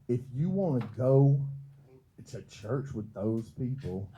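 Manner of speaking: mama's condescending warning, slowing down; a touch of a scornful mother; mimicking mama. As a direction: son imitating his mother's disdain for the church. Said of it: "she said this verbatim".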